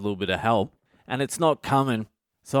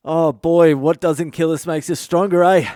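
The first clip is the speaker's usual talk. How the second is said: louder and higher, hammed-up jolly cheater; mock cheery husband; 6 semitones higher, 8 dB louder than usual. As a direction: sell it like a bright, loud pep talk. Continuing the mocked cheery husband.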